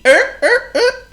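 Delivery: high, loud honking er-er; seal honk. Acted.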